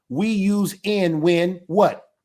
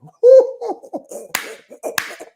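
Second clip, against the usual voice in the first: loud laugh; 8 dB louder than usual. Real, not acted. Laughs at his own skit.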